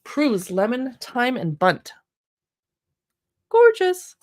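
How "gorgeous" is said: delighted gasp. Real, not acted. Admiring cookbook photo.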